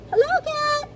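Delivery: very high squeaky duck greeting; duck character. A narrator's reading. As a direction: call out in a tiny, high-pitched duck voice. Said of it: "said Duck".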